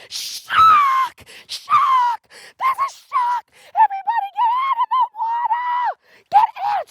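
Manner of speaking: very high, loud screaming warning; terrified scream. Retold or imitated. Girl on the jetty screaming to swimmers, very high panicked yell. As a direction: scream it to everyone in the water. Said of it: "She screamed on the top of their lungs".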